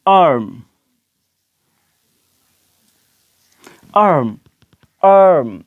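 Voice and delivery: आर्म with a long open aa and clear r; model word arm. Shown as a demonstration. Teacher drilling the class on arm, clear model word. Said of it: "ये word आर्म है".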